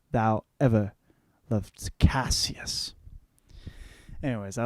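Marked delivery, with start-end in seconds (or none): 0.1-2.9 dramatic Shakespeare recitation. Acted. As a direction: declaim it like a stage actor, big swings of volume.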